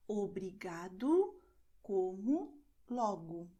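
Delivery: obrigado, como, logo ending in "oo", word by word; final O as U. Shown as a demonstration. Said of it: "pronounced as U".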